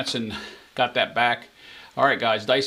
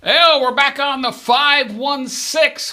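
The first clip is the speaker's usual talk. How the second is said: drawn-out, high, loud casino call; slow, sing-song table call; stickman call; 10 semitones higher, 4 dB louder, much slower than usual. Acted. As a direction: stretch it out like a craps dealer calling the roll. Craps dealer-style roll call.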